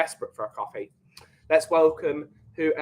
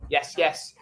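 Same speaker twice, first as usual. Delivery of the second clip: ordinary talk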